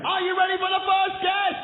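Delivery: high, loud hype-up; hyped shout. Real, not acted. Host shouting to warm up the studio audience. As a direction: fire up the crowd. Warming up the crowd.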